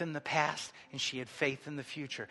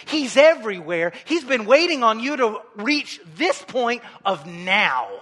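louder, urgent preacher pressing the point; impassioned preaching; 4 semitones higher, 10 dB louder than usual. Acted. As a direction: preach with force to the whole congregation. Preaches at full force.

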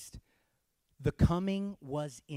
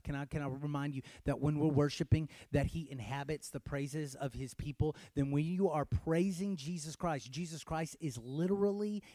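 normal talking voice

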